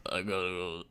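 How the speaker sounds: low, soft gargling-marbles Stallone. Retold or imitated. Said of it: "make it sound like you're gargling marbles".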